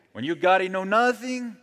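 joking Mexican accent impression. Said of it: "English in the Mexican accent".

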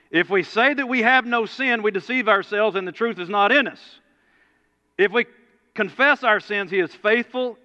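preacher reading First John aloud; scripture preaching. Real, not acted. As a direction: proclaim the verse to the congregation.

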